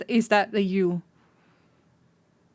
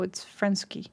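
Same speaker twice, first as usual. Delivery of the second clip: ordinary delivery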